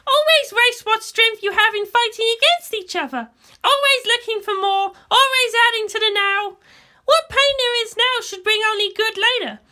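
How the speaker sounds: put-on a young girl arguing her point; Asuka's voice. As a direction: raise the voice to play the headstrong girl. Asuka argues, voiced higher.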